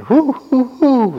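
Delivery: high, loud imitated owl hooting; storyteller's owl call. A narrator's reading. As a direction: hoot like an owl, high and drawn out. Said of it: "the owls would hoot".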